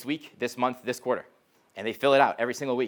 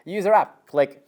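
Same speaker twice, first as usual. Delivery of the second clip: upbeat phone pitch; 6 semitones higher, 7 dB louder than usual. Retold or imitated. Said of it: "And I'd be like".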